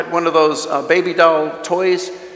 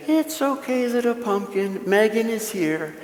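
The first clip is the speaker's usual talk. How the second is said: put-on high, much softer little-girl voice, slow at the end; a small girl gently comforting her crying doll; toddler soothing a doll; 3 semitones higher, 7 dB quieter than usual. Toddler Megan comforting the crying baby doll, high and soft. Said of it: "using the same tone of voice".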